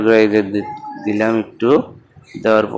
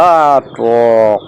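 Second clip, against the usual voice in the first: sour wince; 4 dB louder, much slower than usual. Real, not acted. Tasting sour mango.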